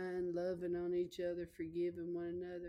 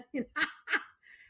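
quick delighted laugh; short happy giggle; high burst of laughter; 9 semitones higher, 5 dB louder than usual. Real, not acted. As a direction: laugh at herself in the story. Laughs at own story.

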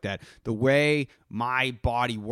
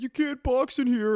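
high mocking voice of the old people; mocking gym regulars; 12 semitones higher than usual. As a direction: whine it like a nagging old gym-goer. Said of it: "they're gonna be like".

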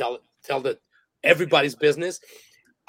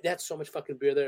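normal talking voice

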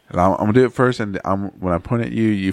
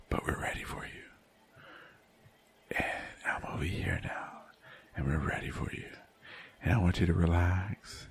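ASMR whisper; 2 semitones lower, 11 dB quieter than usual. Pretend. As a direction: whisper close to the mic to relax. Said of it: "whisper into the microphone".

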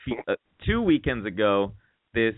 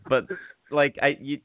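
usual speaking voice

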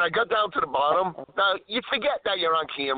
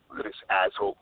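road rage mutter; 8 semitones lower than usual. Real, not acted. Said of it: "I'm driving".

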